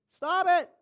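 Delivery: loud, high Stop it; Holy Spirit cutting off the complaining, loud and high; stern command. Retold or imitated. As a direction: snap the order out sharply. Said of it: "Holy Spirit's going".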